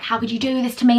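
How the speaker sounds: ordinary delivery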